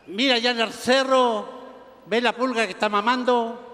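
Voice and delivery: drawn-out Mexican singsong; hammed-up Mexican drawl; Mexican accent. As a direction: lilt it like a joke Mexican. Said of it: "conversaban dos mexicanos".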